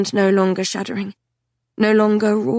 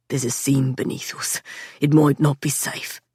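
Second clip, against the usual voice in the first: low warning whisper; 5 semitones lower than usual. A narrator's reading. Patrick warning underground.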